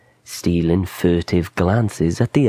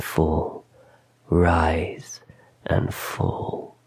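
fading to a whisper; 3 semitones lower, 5 dB quieter, much slower than usual. A narrator's reading. Watching chest rise and fall.

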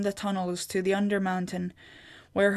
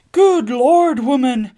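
much higher, loud joyous outburst; overjoyed exclamation; 5 semitones higher, 10 dB louder than usual. A narrator's reading. Husband hugging his lost wife, joyous outburst. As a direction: burst out laughing with relief.

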